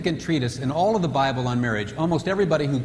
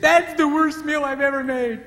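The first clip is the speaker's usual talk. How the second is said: self-critical wife; 12 semitones higher, 4 dB louder than usual. Retold or imitated. Wife after dinner, high and self-critical. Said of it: "So when she said".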